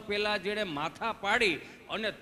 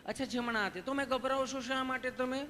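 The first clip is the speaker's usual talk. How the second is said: higher, sweet coaxing voice; coaxing nurse; 5 semitones higher than usual. Retold or imitated. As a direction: soothe a frightened patient. Nurse coaxes nervous patient.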